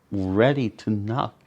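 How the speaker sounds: plain reading voice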